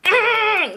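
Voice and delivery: high, drawn-out angry "mm"; frustrated growl. Real, not acted. Storyteller still mad about the story, long frustrated noise. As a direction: let out a long exasperated noise.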